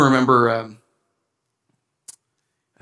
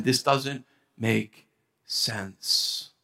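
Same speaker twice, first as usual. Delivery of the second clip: quiet despair; 6 semitones lower, 9 dB quieter, much slower than usual. Retold or imitated. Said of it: "he's, in a nutshell, saying".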